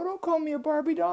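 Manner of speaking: high-pitched protest; squeaky affronted princess; indignant princess. Acted. Princess objecting to the brother's remark, indignant.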